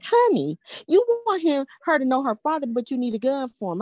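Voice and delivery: high-pitched, exasperated mother reasoning; retold exasperation.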